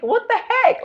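high-pitched "what the heck" in disbelief; amazed exclamation. Retold or imitated. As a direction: jump way up in pitch, stunned and delighted. Amazed at the free bags.

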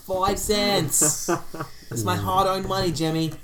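high, hammed-up Boston complaint; terrible Boston accent. Bostonian complaining to Jimmy about bottle caps, hammed-up Boston accent. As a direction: whine about money in a put-on Boston accent. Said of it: "my terrible Boston accent".

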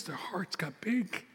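slow, hushed whisper. Acted. Pastor telling the congregation about his children's hearts, whispered. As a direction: breathe it like a secret.

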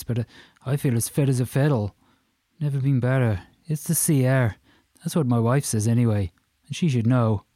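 chatty, boastful Irish brogue; Irish accent. Acted. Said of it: "in a strong Irish accent".

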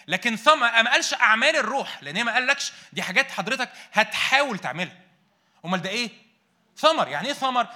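preaching at full force. Acted. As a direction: preach it out loud to the whole church. Preaching loudly to congregation.

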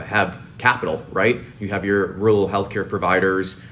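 normal talking voice

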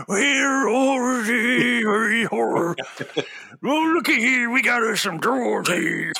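high, slow, hammed-up old miner drawl; old prospector. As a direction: ham up the grizzled prospector. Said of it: "doesn't this one have the old prospector".

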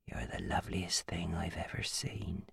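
soft low whisper; possessive whisper. A narrator's reading. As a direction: breathe it to her. Phil whispering.

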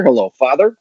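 ordinary talk

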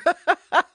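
high nervous giggle; embarrassed laugh. Real, not acted. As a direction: laugh at yourself, a little awkward. Laughing at her own worry.

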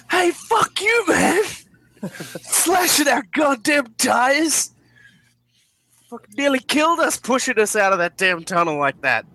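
furious, high-pitched yelling; angry shout. Acted. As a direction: scream it at the driver, enraged. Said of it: "jumps out and shouts".

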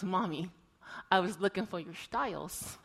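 a hint of a little child's sweet voice; sweet little boy voice. Mother imitating her two-year-old son's sweet voice. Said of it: "In his sweet little two-year-old voice".

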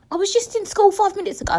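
high, quick whiny exaggeration; mock protest. Bemoaning turning twenty-five to listeners, high mock protest.

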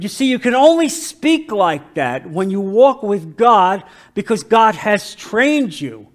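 ordinary delivery

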